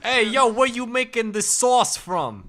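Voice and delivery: high, loud, slow mock Jersey-Italian; hammed-up Jersey diner demanding answers; Jersey-Italian accent. Jersey diner quizzing about the food, hammed-up accent. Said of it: "It's just Italian".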